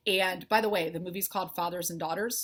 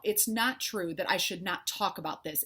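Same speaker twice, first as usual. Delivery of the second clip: ordinary delivery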